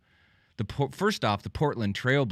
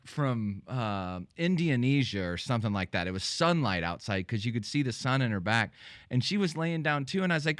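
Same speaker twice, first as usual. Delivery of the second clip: ordinary delivery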